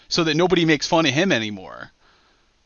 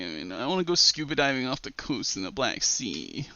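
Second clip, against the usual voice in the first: quiet, mumbling mock Josh dropping to a whisper; mock a broke whiner muttering his excuses, fading into a whisper; mocking Josh's whiny excuses, muttered quietly to a whisper; 2 semitones higher, 6 dB quieter than usual. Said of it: "And then Josh said".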